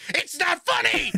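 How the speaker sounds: high slow shouted scold; British woman snapping it's not funny; indignant British woman. Retold or imitated. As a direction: bark it at the laughing guest. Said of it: "She's like".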